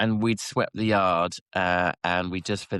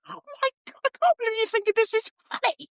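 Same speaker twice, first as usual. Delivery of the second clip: shrill falsetto put-on voice, much higher; mock squealing mum. Boss gushing at her son's mess, very high. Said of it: "mock boss voice again".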